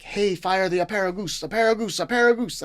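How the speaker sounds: put-on chef barking an order in a thick French accent, pitch climbing. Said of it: "Had a very thick French accent".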